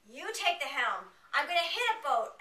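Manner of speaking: high, loud panicked shriek; panicked screaming. Retold or imitated. Screaming at her boyfriend while the thruster fails, panicked yelling. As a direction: yell it like the boat is drifting into others. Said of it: "I started screaming at my boyfriend".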